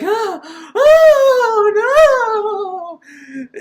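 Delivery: high, loud, limp fake scream; badly acted cry. Reviewer mimicking Erica's flat scream, high and loud. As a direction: parody a terrible voiceover actress. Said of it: "she says it exactly like that".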